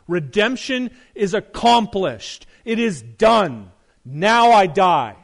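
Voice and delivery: triumphant declaration. Acted. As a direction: proclaim it as a victory cry, building to the last words. Preacher proclaims Christ's victory cry.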